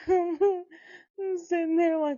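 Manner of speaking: laughing. Real, not acted. Laughing mid-story.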